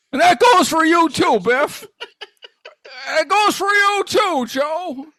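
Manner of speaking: high, loud, breaking Jimmy Stewart rant; Jimmy Stewart tirade. Retold or imitated. Host as Jimmy Stewart ranting at the hosts like Mister Potter. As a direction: rail at Mister Potter near tears. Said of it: "I can't get out of the voice".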